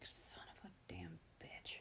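slow, whispered swearing to yourself; muttered curse. Retold or imitated. Host retelling frustration at the accent, slow whispered curse. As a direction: curse under your breath, drawn out.

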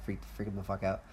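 ordinary talk

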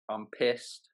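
native British "pissed"; British demo; British accent. Shown as a demonstration. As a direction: model "pissed" in everyday British. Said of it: "Give us two more".

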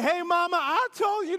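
put-on sassy kid to mama. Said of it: "Look at your mama, say".

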